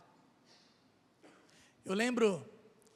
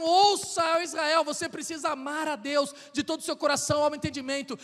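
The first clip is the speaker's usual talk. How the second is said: proclaimed scripture; 5 dB louder than usual. Acted. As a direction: declaim it like a prophet crying out. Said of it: "se não tiver um".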